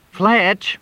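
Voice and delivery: high, whiny call of his name; complaining. Acted. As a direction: complain loudly from the back. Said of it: "Crystal complained".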